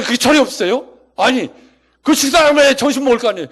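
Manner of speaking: angry protest building to a high, full-blown shout; confronting the pastor. Acted. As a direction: yell at the pastor like a fed-up kitchen volunteer. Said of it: "와서 목사한테 따지는 거예요". (목사한테 고래고래 소리치며 따지는 말투)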